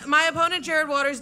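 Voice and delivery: ordinary delivery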